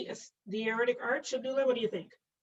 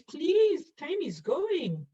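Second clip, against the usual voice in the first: urgent plea; 7 semitones higher, 4 dB louder, much slower than usual. Real, not acted. Urging students to answer.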